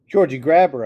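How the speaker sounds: loud urgent yell at her brother. A narrator's reading. Sister yelling at brother to grab the puppy, loud yell. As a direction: shout it, panicked. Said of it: "I yelled".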